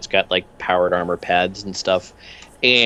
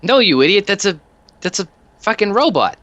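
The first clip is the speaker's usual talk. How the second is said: much higher, cheery odd little thug; much higher goofy henchman voice; Peanuts voice; 11 semitones higher, 3 dB louder than usual. Acted. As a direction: chirp the question brightly.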